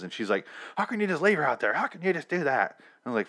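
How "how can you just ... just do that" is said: high-pitched imitation of an upset mother; mock yelling. Carol berating the men who stopped searching, mock yell. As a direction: mimic her complaint, pitched well up without shouting. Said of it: "literally only yelling at the person".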